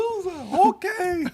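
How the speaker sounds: very high falsetto cartoon mouse; mocking high mouse voice; Mickey Mouse. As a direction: squeak it out like a little cartoon mascot. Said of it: "Mickey Mouse voice".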